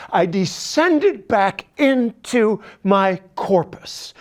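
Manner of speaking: ordinary talk, nothing marked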